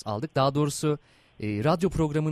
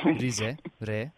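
letter by letter, naming a town for each; spelling it out. Shown as a demonstration. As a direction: spell it out like over a bad phone line. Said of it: "kodluyorum şimdi harfleri".

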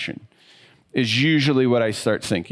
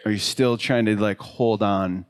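reflective sermon invitation; 3 semitones lower than usual. Acted. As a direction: gently invite the listeners to look inward.

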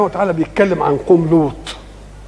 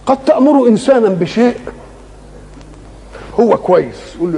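usual speaking voice

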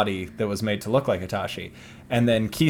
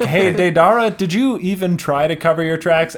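exasperated call-out; 5 semitones higher, 4 dB louder than usual. Retold or imitated. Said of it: "they're like".

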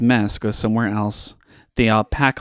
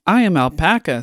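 higher alpaca reply; Alpaca's voice; 6 semitones higher than usual. A narrator's reading. The last Alpaca answers Llama, in a raised voice. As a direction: answer back just as formally. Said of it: "said Alpaca".

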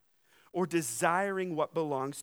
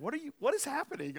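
mock disbelief; 10 semitones higher, faster than usual. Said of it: "I was like".

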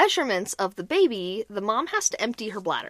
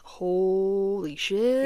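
very slow, drawn-out expletive; stunned; 3 semitones lower, much slower than usual. Real, not acted. Joker marvels at the backfire, slow stunned drawl. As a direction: stretch each word in disbelief.

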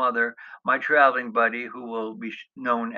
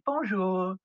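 a light French bonjour, higher; a friendly French hotel greeting; French accent; 7 semitones higher than usual. Retold or imitated. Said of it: "with a French accent".

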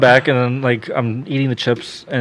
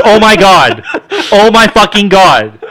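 much higher and louder, slow and laughing through it; stunned disbelief; 9 semitones higher, 9 dB louder, slower than usual. Retold or imitated. Laughing shocked cousin reacting to the birth, loud and high. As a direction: cry out in horrified amazement. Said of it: "He goes".

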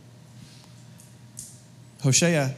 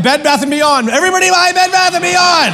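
much higher, louder mock shouting; hype shout; 11 semitones higher, 7 dB louder than usual. Preacher mocking investors chasing tips, hyped shout. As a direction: yell it like a stock pumper.

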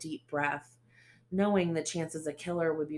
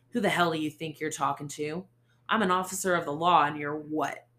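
loud threatening question; menacing anger; 6 dB louder than usual. Acted. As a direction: tower over her, loud. Ace grabs her arm.

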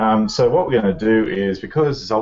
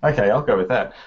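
ordinary talk, nothing marked

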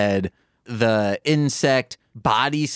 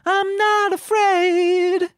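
mock falsetto, drawn out slow; squeaky high voice; much slower than usual. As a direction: squeak it out like a scared kid. Mocking reply, very high.

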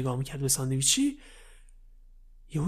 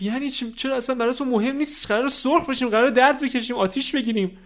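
high, loud frightened outburst; panicked protest; 9 semitones higher, 6 dB louder than usual. Acted. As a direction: panic about burning. Kid panics about dying.

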